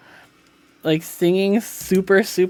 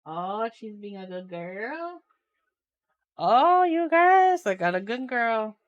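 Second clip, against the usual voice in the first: praising pet; 5 semitones higher than usual. Real, not acted. Streamer praising her dog to viewers, high doting baby talk. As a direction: gush over the good dog.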